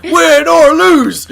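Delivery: high, drawn-out yell; hammed-up victory cry; rowdy celebrating shout. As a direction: bellow it slowly like a crazed fan. Plays rioting cop celebrating.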